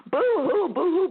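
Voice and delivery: high, loud first mock sob, then dropping; mock boo-hoo. Host teasing herself for crying on air, mock sobbing. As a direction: make fun of your own crying. Mocks her own crying.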